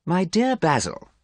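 high, slow, drawn-out boredom; languid, bored young gentleman. A narrator's reading. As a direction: address a friend with weary condescension. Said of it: "Dorian sounded very bored".

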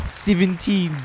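Seventeen with the e's flattened to "sivventeen", Kiwi style; Kiwi pronunciation. Retold or imitated. Host showing how Kiwis say Seventeen, "sivventeen". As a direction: say Seventeen as "sivventeen" the New Zealand way. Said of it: "how they say seventeen".

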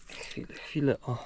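whispered under breath, intent on a find; whispered wait, wait. Real, not acted. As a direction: hush it while digging something out.

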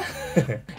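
soft, brief falling chuckle; short chuckle. Real, not acted. Reviewer amused by his own price joke, soft chuckle. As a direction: let out a quiet little laugh.

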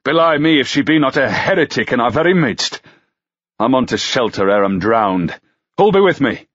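John Hardy urging the company out of the rain; rallying pilgrim. Acted. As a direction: call the others to shelter. Man rallies the others.

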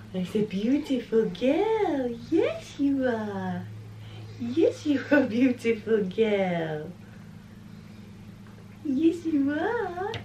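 slow, high, drawn-out coo. Real, not acted. Mother praising her baby girl, slow and cooing. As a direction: coo each word slowly up high.

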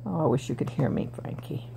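whispering. Real, not acted. Wistful words to her pet.

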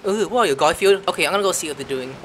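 normal talking voice

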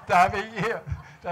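high, loud laugh into stammered words; real laughter. Real, not acted. Author laughs describing the mouse mask, real laughter. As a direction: laugh so hard the words stumble.

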